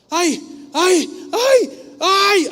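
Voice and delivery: high, loud cries of pain as the arm stretches. Retold or imitated. Grandfather straining his healed arm, crying out in pain. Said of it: "así literal como yo estoy haciendo".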